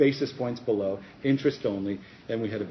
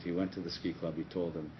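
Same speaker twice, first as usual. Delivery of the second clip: normal talking voice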